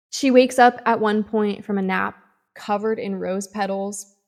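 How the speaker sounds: normal talking voice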